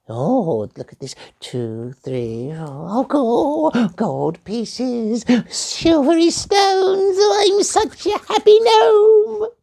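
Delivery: squeaky high delighted counting; gleeful gnome. Acted. Gnome gloats over treasure.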